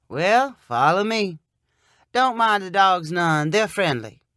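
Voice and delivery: slow, easy Southern drawl; unhurried, friendly rural man; slow country man. A narrator's reading. Sonny leading Bryn past his dogs, slow drawl.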